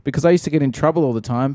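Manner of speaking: ordinary talk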